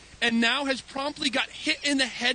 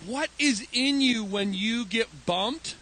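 normal talking voice

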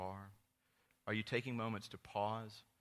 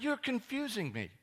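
high, loud sarcastic squeak; mock exasperated self; 14 semitones higher, 6 dB louder than usual. Pastor retelling his reply to a trendy friend, high sarcastic voice. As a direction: play your younger self, baffled and mocking. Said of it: "And I was like".

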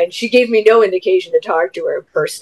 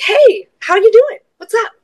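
friendly call-out; 4 dB louder than usual. Retold or imitated. Stepmom greeting a stranger at the carnival, friendly call-out. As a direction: call over in an overly chirpy high voice.

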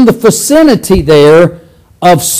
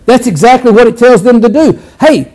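usual speaking voice

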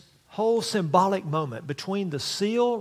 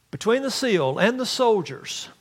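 normal talking voice

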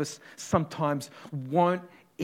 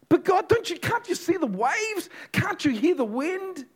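much higher and louder, frantic pleading; desperate cry; 5 dB louder than usual. Acted. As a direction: plead over the roar of the storm. Disciples cry out to God.

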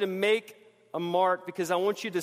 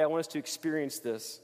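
usual speaking voice

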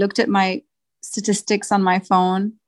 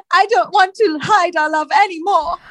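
higher, swooning lover's voice; lovesick fruit; 8 semitones higher than usual. Acted. Pine confessing love to Apple, swooning and higher. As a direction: melodramatic romance-novel lover.

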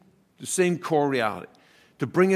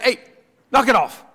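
sharp raised scolding call; mother scolding; 7 semitones higher, 5 dB louder than usual. Retold or imitated. Mom calling her kids to order, raised and sharp. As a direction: snap it out like a mom stopping the kids.

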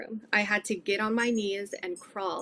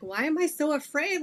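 mildly panicked question, pitched higher; retold freaking out; 6 semitones higher than usual. Retelling her panic in the church parking lot, mildly freaked out. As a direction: question yourself with a touch of alarm. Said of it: "I'm freaking out".

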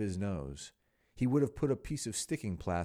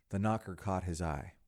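ordinary delivery

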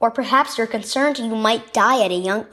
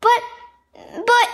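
stuttering; 11 semitones higher, 4 dB louder than usual. A narrator's reading. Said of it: "Asher stuttered".

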